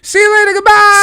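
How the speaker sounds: loud high-pitched sign-off; shouted goodbye. Pretend. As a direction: yell the goodbye.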